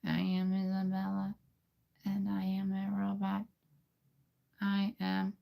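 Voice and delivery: put-on high, soft girl voice, whispered in the middle; girl and robot blended into one; female robot voice. Shown as a demonstration. Trying a female robot for viewers, high and soft. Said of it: "Now let's try to put those two together".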